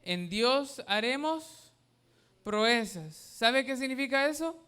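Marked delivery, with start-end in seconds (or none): 0.0-3.1 slow, weighty verse reading; slow emphatic verse. Acted. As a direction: lean on Dios, draw it out. Reads verse slowly.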